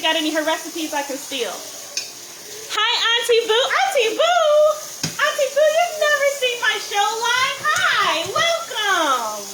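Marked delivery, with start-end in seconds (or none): 1.5-9.4 real delighted squeal of welcome, high and loud; excited greeting. Real, not acted. Host greeting Auntie Boo on her first live show, excited. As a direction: call out high and loud to a favourite aunt.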